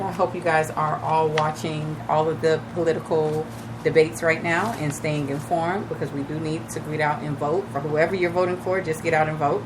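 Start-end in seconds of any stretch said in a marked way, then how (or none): none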